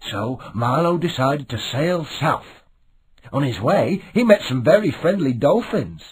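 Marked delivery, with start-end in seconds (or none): none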